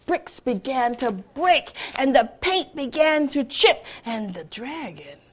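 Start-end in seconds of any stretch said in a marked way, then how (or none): none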